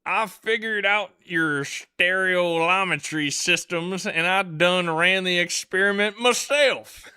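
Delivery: loud, high, slow Alabama drawl; Alabama accent. Acted. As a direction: ham up a drawn-out Southern Alabama accent. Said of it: "I read it in that accent".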